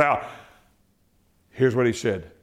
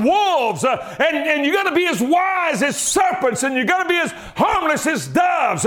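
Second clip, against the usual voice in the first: preaching at full force; 11 semitones higher than usual. Acted. Preacher to congregation about the gospel, shouting. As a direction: preach it out big.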